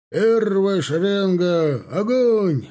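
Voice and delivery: high, drawn-out military command; barked firing command. A narrator's reading. Captain ordering a volley, barked command. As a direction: call the volley to the whole rank. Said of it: "рявкнул капитан Бегов".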